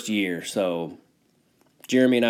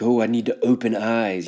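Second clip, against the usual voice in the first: pretentious mock voice. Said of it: "that's the last thing I wanna sound like".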